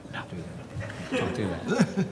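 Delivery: low unvoiced don't do that; muttered warning. Leno warning him off the impression, muttered. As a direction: hiss it under your breath. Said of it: "no one can see him going".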